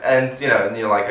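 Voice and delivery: usual speaking voice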